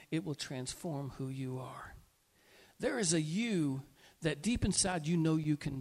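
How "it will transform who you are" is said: hushed, intimate whisper. Real, not acted. Preacher to congregation, whispering the promise. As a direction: lean in and breathe it.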